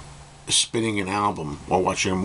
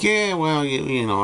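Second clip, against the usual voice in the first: imitated lukewarm reaction; 8 semitones higher than usual. Young girl reacting to Revolver, imitated hedging. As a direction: voice a listener hedging, pitched well up. Voicing unimpressed young Beatles listener.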